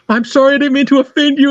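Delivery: high-pitched imitated apology; very apologetic. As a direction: imitate a caller hurrying to apologize. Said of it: "very apologetic".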